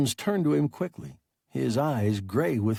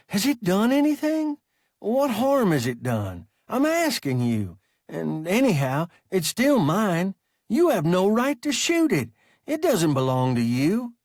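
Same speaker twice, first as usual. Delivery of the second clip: agitated pleading; 7 semitones higher, 4 dB louder than usual. Acted. Peterson pleading against the shooting.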